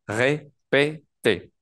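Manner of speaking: "répétez" slowly, syllable by syllable, each ending in "é"; silent R. Shown as a demonstration. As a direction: break "répétez" into ré, pé, té. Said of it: "esa E se pronuncie E".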